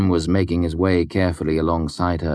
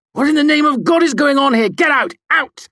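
high, loud outraged yell; angry shouting; 8 dB louder than usual. A narrator's reading. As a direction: burst in bellowing at everyone to clear off. Angry man storms into rooms.

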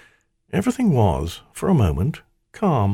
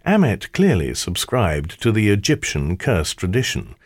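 usual speaking voice

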